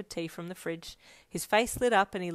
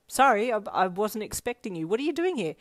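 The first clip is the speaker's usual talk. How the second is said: higher, louder flustered apology; flustered surprise; 4 semitones higher, 6 dB louder than usual. A narrator's reading. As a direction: apologise while caught off guard. Surprised by unexpected visitor.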